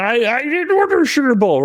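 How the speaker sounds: very high, mock protest; hammed-up indignant denial; exasperated prank victim. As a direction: imitate a guy objecting at a high pitch. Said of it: "you could hear him saying".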